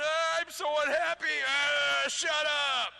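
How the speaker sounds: hammed-up whiny outburst; mock unhappy ranting. Imagining liberals in charge, mock angry whining. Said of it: "would we be going".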